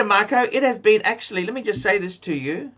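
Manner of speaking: normal talking voice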